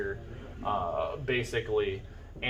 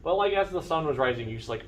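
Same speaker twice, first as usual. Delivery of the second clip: ordinary delivery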